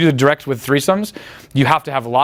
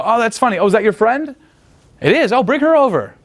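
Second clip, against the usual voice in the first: mock dialogue; 8 semitones higher than usual. Acted dialogue, high pitch.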